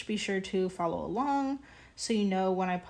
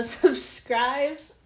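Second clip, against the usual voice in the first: laughing; 5 semitones higher, 7 dB louder than usual. Real, not acted. Still giggling.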